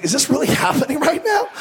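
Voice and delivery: high, incredulous disbelief. Retold or imitated. Friend facing an angry neighbor, high incredulous disbelief. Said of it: "He's like".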